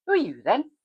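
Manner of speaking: put-on old Welsh farmer asking; Welsh accent. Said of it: "in his Welsh accent".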